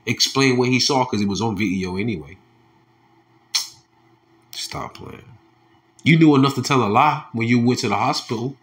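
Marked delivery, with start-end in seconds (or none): 4.5-5.2 scornful whisper; voiceless dismissive whisper; whispered rebuke. Real, not acted. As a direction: hiss it under the breath. Scornful aside to the killer.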